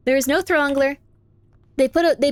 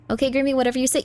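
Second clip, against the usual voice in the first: ordinary delivery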